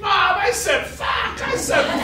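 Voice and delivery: put-on very high, drawn-out child's scream; screaming confession. Six-year-old racing to confess to Mom, high panicked scream. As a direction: scream it out in mock kid panic. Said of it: "I'm just screaming".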